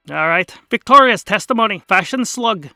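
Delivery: projected announcing voice; loud reading out. Acted. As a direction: call the names out across the lot. Scarlet reading, getting loud.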